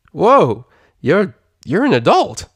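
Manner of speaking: loud, high startled whoa; surprised. A narrator's reading. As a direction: gasp it out in disbelief. Rainbow Dash surprised.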